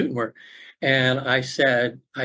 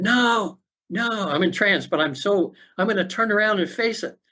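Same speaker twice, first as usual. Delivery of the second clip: firmly refuse, pitched up; emphatic refusal; 7 semitones higher than usual. Retold or imitated. Client retelling a refusal to the facilitator, emphatic.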